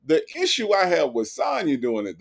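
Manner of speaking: ordinary delivery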